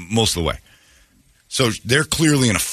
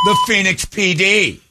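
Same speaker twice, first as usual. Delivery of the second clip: slow, high-pitched mock scream; mimicked screaming; 10 semitones higher than usual. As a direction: stretch it out as an imitation of her yelling.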